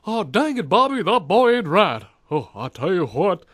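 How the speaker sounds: Hank Hill. Retold or imitated. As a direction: imitate an American dad scolding his boy. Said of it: "trying to impersonate Hank Hill".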